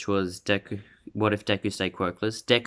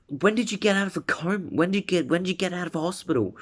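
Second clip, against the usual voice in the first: high, rushed string of questions; astonished questioning; 9 semitones higher, much faster than usual. Acted. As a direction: fire the questions out in disbelief. Character fires astonished questions at friend.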